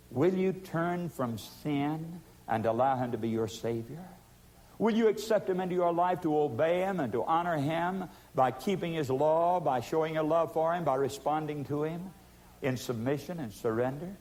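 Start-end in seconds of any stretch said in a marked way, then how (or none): none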